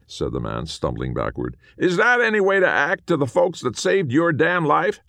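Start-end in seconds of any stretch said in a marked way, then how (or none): 1.8-5.0 high, loud indignant cowboy; bristle, high and loud; offended little ghost. A narrator's reading. Small man indignant.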